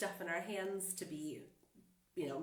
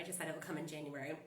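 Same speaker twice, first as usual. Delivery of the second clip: usual speaking voice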